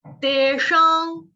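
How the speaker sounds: very slow तेषां, nasal ां; slow nasal teacher demo; nasal ending. Shown as a demonstration. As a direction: stretch तेषां with nasal end. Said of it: "नाक और मुंह हम दोनों से".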